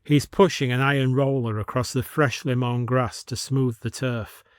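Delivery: usual speaking voice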